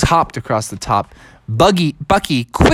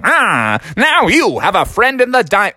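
high, swooping radio pitchman; hammy advertiser's sing-song; Tom Shane; 6 semitones higher than usual. Acted. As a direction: croon it like an ad slogan. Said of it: "hear the guy over there saying".

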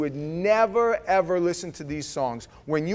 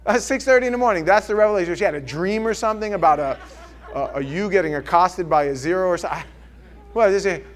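loud and fast; gleeful amazement; excited; 3 semitones higher, 7 dB louder, faster than usual. Real, not acted. Excited laughing retelling.

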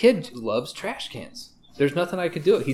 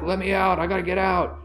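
dog begging; 8 semitones higher, 4 dB louder than usual. Retold or imitated. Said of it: "the signs like".

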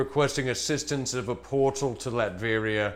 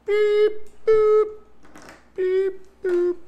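high beeping robot chirps; toy robot voice; 7 dB louder, slower than usual. Pretend. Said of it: "with my beep boops".

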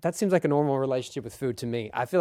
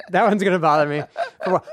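laughing through the words, a bit louder; 3 semitones higher, 5 dB louder, faster than usual. Real, not acted. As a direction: say it again while laughing. Repeats line through laughter.

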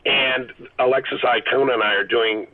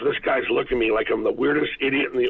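normal talking voice

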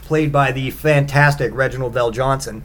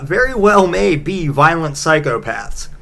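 ordinary talk

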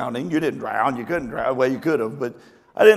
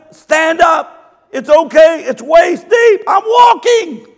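slow loud shout; 13 semitones higher, 10 dB louder, slower than usual. Retold or imitated. Pastor in the river yelling to panicking ladies, slow loud shout. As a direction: call out slowly, high and loud.

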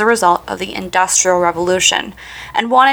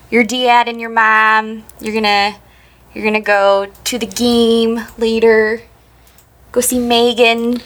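Cleveland accent. Shown as a demonstration. Host showing how Cleveland talks, Cleveland accent. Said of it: "there's that Cleveland A".

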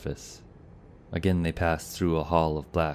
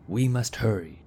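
higher-pitched, mildly urgent exclamation; 6 semitones higher than usual. A narrator's reading. Knight urging his companions on, exclaimed. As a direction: exclaim it, pitched up but not shouted. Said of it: "the Silver Knight exclaimed".

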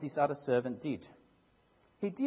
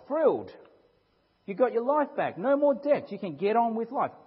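lifted, louder and higher, full of relief; preacher acting out the forgiven servant's joy, louder and higher; thrilled; 6 semitones higher, 4 dB louder than usual. Preacher voicing the freed servant's joy, thrilled and louder.

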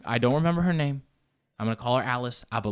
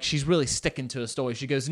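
ordinary delivery

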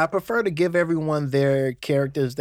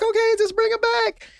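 very high put-on female voice; sweet, trusting request; girlfriend again. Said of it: "it's like".

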